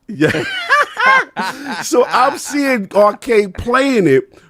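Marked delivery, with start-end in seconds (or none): none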